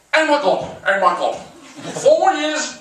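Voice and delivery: high, slow mock panic; hammed-up posh terror; terrified Lord Archer. New prisoner panicking on arrival at Belmarsh, mock terror. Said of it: "He was fucking terrified".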